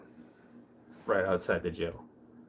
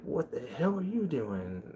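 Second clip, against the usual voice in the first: higher-pitched imitation of an indignant guy; mock outrage; 6 semitones higher than usual. Streamer imitating man confronting him in the shower, mock outrage. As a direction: act out a startled objection without raising volume.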